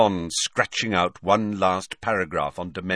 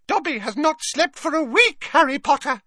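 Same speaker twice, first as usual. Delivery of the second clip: high, proud little squeak; proud squeaky elf; 5 dB louder than usual. A narrator's reading. Elf boasting of his sleepless week, squeaking proudly. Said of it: "said Dobby proudly".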